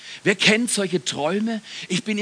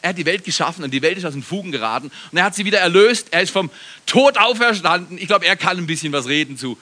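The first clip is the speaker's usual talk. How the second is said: ordinary talk, nothing marked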